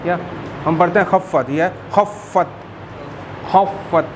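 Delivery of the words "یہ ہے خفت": خفت breathed out, softer and very slow; breathy kh. Shown as a demonstration. Teacher repeating خفت to model the خ. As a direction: breathe out a long scraping خ in خفت. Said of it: "خا کی آواز نکالنی ہے".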